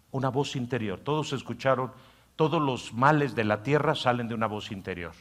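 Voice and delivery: ordinary delivery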